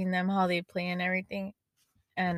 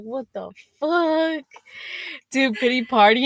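tripping out; 4 semitones higher, 4 dB louder than usual. Retold or imitated. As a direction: stretch it out, totally thrown. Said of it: "I was tripping out".

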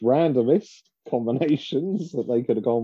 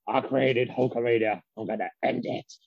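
imitating Vince McMahon; 4 dB quieter than usual. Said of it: "Vince sort of battering Hogan".

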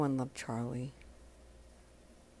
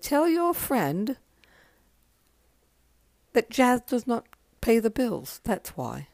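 higher, louder and very slow, a mock-dry answer; 7 semitones higher, 5 dB louder, slower than usual. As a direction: hand down the verdict slowly. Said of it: "And he said".

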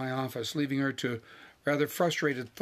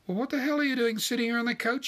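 high-pitched exasperated demand; indignant demand; 10 semitones higher, faster than usual. A narrator's reading. Wife ordering husband off the couch, indignant demand. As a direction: demand an answer, voice pitched right up. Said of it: "she demanded".

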